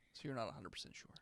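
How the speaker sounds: unvoiced whisper. Real, not acted. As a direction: hush it so he can't hear. Secret aside.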